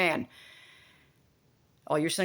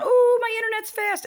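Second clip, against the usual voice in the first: mock excited; 6 dB louder than usual. Mocking excited phone user.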